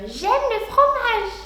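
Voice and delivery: squeaky little mouse voice, slow and high; tiny high voice like a scurrying mouse. Acted. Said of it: "genre avec une toute petite voix".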